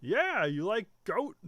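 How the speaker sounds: slow, high-pitched simple brute; dopey, drawn-out ship's cook; half Sloth, half Lenny. Acted. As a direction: ask it like an eager big oaf. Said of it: "half Sloth and half Lenny".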